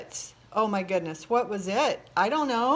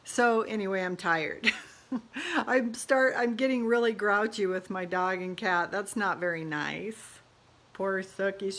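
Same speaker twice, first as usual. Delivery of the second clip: ordinary talk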